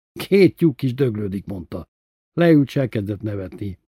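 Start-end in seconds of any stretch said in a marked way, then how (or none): none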